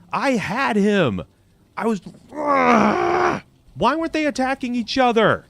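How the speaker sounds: genuinely angry, without shouting; indignant anger. Real, not acted. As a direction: protest the unfairness, stretching key words. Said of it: "I am legitimately angry right now".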